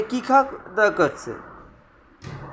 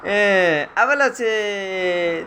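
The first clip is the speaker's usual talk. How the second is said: drawn out; much slower than usual. Real, not acted. Parent coaxing toddler to walk.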